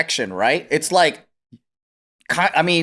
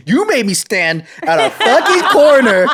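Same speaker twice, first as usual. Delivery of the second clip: raised, louder pretend rant breaking into laughter; mock outraged complaint; 7 semitones higher, 6 dB louder than usual. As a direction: play an indignant grown-up kid, laughing. Imagined grown child yelling complaint.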